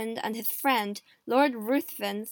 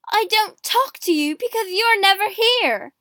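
loud, high, heated comeback; furious retort; 9 semitones higher, 9 dB louder than usual. A narrator's reading. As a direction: fire the blame straight back. Queen quarrels with husband.

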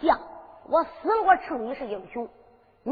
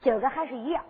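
plain reading voice

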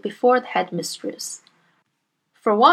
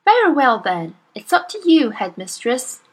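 ordinary delivery

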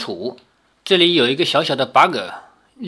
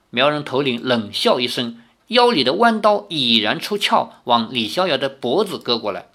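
ordinary delivery

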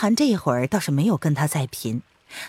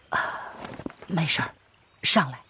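weary dismissal; 6 dB quieter than usual. Acted. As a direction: play down the injury quietly. Injured man brushing off worry. (低声淡淡地逞强)

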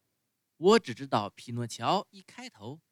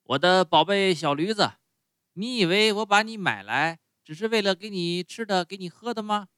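louder, higher master calling out to his donkey; 4 semitones higher, 6 dB louder than usual. Acted. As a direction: bellow at the donkey as you walk in. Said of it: "叫着说". (主人一进畜栏就大声冲驴子喊)

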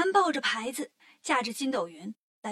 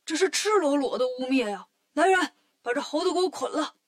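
raised voice, barking an order to the guards; furious order; 2 semitones higher, 4 dB louder than usual. Acted. The Heavenly King orders the monkey tied up, furious. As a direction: seize him, outraged at the slander. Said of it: "更生气了".

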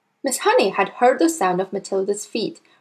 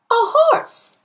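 put-on little girl voice; 3 dB louder than usual. A narrator's reading. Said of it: "Matilda said".

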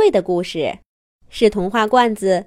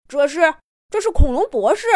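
blurted surprise; 4 semitones higher than usual. Acted. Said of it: "脱口而出".

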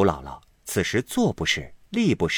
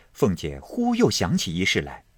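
ordinary delivery

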